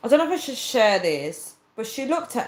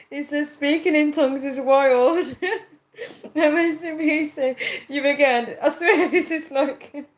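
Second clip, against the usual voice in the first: talking through real laughter, pitched up; cracking up; 6 semitones higher than usual. Real, not acted. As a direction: keep dissolving into giggles as you talk. Cracking up at comments on live stream.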